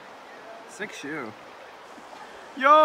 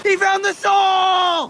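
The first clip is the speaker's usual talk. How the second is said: excited; 10 semitones higher, 5 dB louder, much slower than usual. Real, not acted. Excited discovery of lost shoe.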